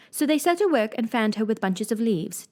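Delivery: usual speaking voice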